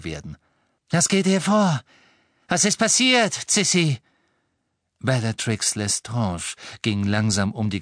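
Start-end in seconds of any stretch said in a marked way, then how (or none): 0.9-4.0 high, loud, imperious-sounding demand; dreaded woman. A narrator's reading. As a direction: demand to know what happened, voice raised. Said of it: "Klang ihrer Stimme ließ Harrys Angst". (herrisch fragend, laut und hoch)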